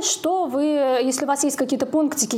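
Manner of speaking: ordinary delivery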